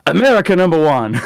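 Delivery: loud, high mock proclamation of an old Korean man; old man proclaiming. Quoting old Korean guy.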